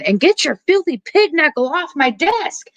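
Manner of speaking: high, shrill-pitched retold scream at the coworker; mock scream. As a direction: shriek it out, losing it in the middle of the bank. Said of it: "starts screaming".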